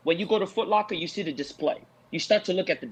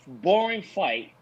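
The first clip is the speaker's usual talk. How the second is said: ordinary talk, nothing marked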